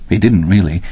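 ordinary delivery

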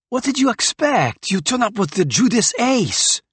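loud, high angry outburst. A narrator's reading. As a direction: shout it in fury. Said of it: "said Bennell angrily".

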